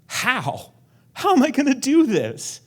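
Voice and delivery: high, loud, frantic plea; panicked how. Retold or imitated. Overwhelmed believer crying out to God, high and loud.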